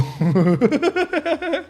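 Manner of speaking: squeaky high laugh bursting out; high burst of laughter. Real, not acted. Streamer reading an insult aloud, high cracking laugh. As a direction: crack up in a high-pitched giggle.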